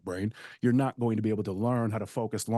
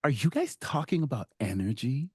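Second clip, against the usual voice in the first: lightly voiced nosy mom, a bit higher; a hint of the prying group mom; annoying mom; 6 semitones higher than usual. Nosy mom butting into a private talk, lightly voiced. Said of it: "like the annoying mom".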